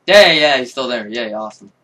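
ordinary delivery

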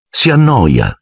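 usual speaking voice

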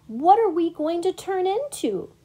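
high-pitched excited question; eager curiosity. A narrator's reading. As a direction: ask as if you can hardly wait. Eager pollywog asks caterpillar.

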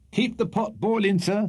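high, lively cheering; cheerful cheer. Acted. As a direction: urge them on. Sam cheering on sliders.

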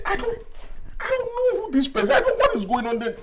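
panicked denial. Acted. Denies knowing the intruder.

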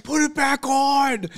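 high, drawn-out stadium yell; screaming at the player. Retold or imitated. Fan heckling a quarterback, screaming. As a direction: bellow it across the field at him. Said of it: "Fucking screaming".

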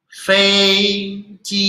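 Fei and ji held high and level; long, steady high tone on "Fei" and "ji"; first tone twice. Shown as a demonstration. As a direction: sustain "Fei", then "ji", on one high pitch. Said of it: "ini ada satu, ada satu".

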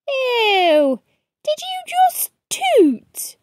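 high, slow squeal of disgust; grossed-out kid. Acted. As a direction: recoil from the smell in a high voice. Someone reacts to a smell.

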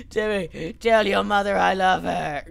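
hammed-up strained last words; dying dad. Dad impaled, last words to son, hammed-up dying voice. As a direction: gasp it out as mock dying words.

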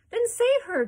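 high, very loud demand; crying out. A narrator's reading. Mother demanding her daughter's rescue, loud cry. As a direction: shout it urgently at the top of your range. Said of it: "cried Missus Salt".

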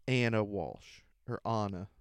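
ordinary talk

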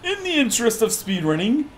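calling up. Acted. Calling up to boss from below.

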